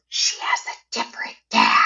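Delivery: a sick mother whispering a secret; whispered mom quote. Retold or imitated. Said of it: "she said it like Spicy Mom".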